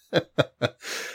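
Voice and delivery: breathy, suppressed whispered giggle; stifled giggle. Real, not acted. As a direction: snort back a laugh. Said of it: "No, it's not gonna work".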